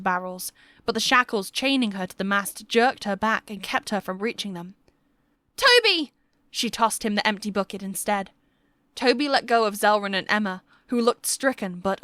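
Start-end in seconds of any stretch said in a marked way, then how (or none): 5.6-6.1 desperate shout. Acted. Alwyn shouting to Toby across the deck, loud and urgent. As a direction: yell the name high and loud. Alwyn calls out amid fire.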